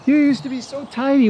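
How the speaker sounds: teasing pet talk. Real, not acted. Teasing the horse directly.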